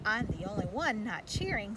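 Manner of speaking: ordinary delivery, nothing marked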